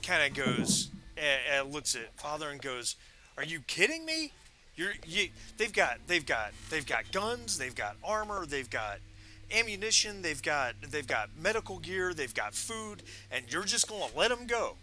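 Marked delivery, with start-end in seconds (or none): 3.4-14.8 higher, exasperated protest; incredulous outburst. Acted. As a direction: plead in disbelief to Father. GM voices Brutal arguing.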